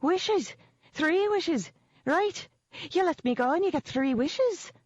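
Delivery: high, urgent little voice bargaining; desperate leprechaun offering wishes; pleading leprechaun. A narrator's reading. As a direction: beg in a tiny high voice.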